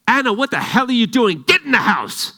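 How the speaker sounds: high, loud furious yell at his daughter; angry shout. Retold or imitated. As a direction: scream it like a panicked, furious dad. Father yells at daughter after fall.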